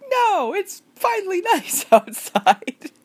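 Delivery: high, loud, slow, laughing protest; exasperated protest. Retold or imitated. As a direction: argue back at the phone, half laughing. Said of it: "And you're like".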